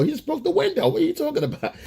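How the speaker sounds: high indignant squeal of disbelief; incredulous protest. Retold or imitated. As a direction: squeak out your disbelief at her. Exasperated reply to protective mom.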